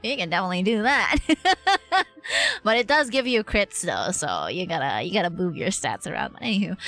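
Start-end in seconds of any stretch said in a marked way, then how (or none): 1.2-2.0 short laugh. Real, not acted. Short laugh after joke.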